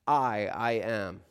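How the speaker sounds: slow, weighty I, I am; slow translation. Shown as a demonstration. Pastor translates the Greek for congregation, slow and weighty. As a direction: let I, I am land slowly. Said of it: "it is ego eimi".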